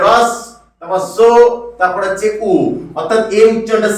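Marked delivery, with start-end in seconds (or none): 0.1-2.5 "রস", "সো", "উ" piece by piece with pauses; broken-down pronunciation. Shown as a demonstration. Teacher breaking the name down for students, "রস", "সো", "উ" in separate pieces. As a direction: separate each part: "রস", then "সো", then "উ". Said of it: "ঠিক তেমন এর উচ্চারণ হয়ে যাবে".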